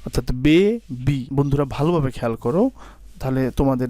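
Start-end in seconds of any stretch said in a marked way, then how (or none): 0.3-1.3 baby drawn out slowly in two parts; teacher sounding out baby; slow syllable demo. Shown as a demonstration. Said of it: "সেটা হচ্ছে baby".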